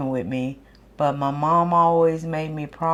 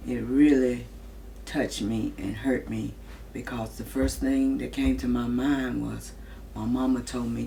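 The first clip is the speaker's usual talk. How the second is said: softer, lower heartfelt words; sad; 6 semitones lower, 6 dB quieter than usual. Real, not acted. Mother recalling the promise to her mama, sad soft voice. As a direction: voice dropping with hurt.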